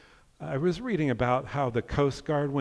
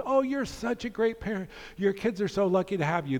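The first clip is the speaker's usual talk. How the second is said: mock kind shouting; 8 semitones higher than usual. Street-corner stranger praising a passing parent, high and cheery. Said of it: "shouting at the top of their lungs very kind things".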